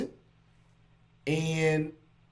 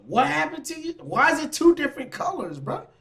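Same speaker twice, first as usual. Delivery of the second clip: higher, puzzled mock clerk voice; dry cleaner asking; 11 semitones higher than usual. Dry cleaner questioning her about the stained clothes, puzzled mock voice. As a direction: play the baffled dry cleaner. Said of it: "they gonna ask her".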